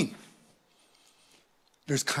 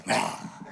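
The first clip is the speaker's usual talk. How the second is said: short, low mock laugh; tongue laugh; 7 dB quieter than usual. Shown as a demonstration. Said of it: "I love the tongue laugh".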